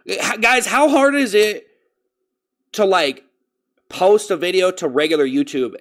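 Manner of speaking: high, loud, incredulous appeal; exasperated plea. Real, not acted. Streamer asking viewers how hard it is, exasperated. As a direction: stretch it out in frustration.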